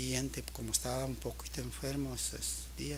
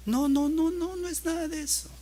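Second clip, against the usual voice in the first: high-pitched imitation of a child insisting; child's protest; 7 dB louder than usual. Son denying father's guesses about what troubles him, high child's protest. Acts out son's upset denial.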